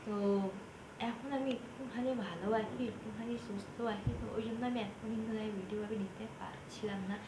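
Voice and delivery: speaking through a worn-out throat, softly; hoarse voice. Real, not acted. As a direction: voice gone from coughing, talking quietly to friends. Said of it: "ভয়েসটা একেবারে বসে গিয়ে তোমরা হয়তো শুনতেও পাচ্ছ".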